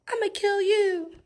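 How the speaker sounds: high mock threat; high-pitched, hammed-up menace; crazy lady's threat. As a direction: imitate a crazy lady sending threats. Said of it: "one crazy lady sent a message".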